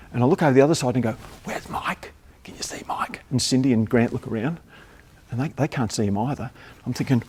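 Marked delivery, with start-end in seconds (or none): 1.4-3.2 urgent whispered call; hushed backstage whisper. Retold or imitated. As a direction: whisper across the stage. Searching backstage across stage.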